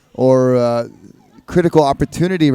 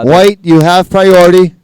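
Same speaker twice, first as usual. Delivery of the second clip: louder priority call over the water; calling out to the surfer; 4 semitones higher, 12 dB louder than usual. Real, not acted.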